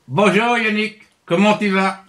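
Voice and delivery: hint of a cartoon bear voice; Syllabe the bear. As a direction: chat as a puppet bear greeting a friend. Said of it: "je suis l'ours Syllabe".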